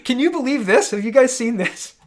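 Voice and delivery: loud, high, exasperated; outraged. Real, not acted. Streamer to her chat, loud outraged disbelief. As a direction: throw it at the viewers in disbelief. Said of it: "outraged at viewers".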